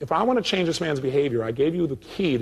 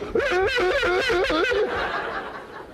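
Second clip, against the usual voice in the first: very high rising mock shriek; mock phobic shriek. Imitating the snake-phobic woman's panicked shriek.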